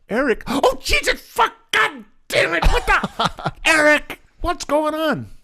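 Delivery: hammed-up panicked yelling for a husband; startled screaming. Wife finds Kurt Russell dummy in bed, startled shrieking. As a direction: imitate a wife's high, loud shriek.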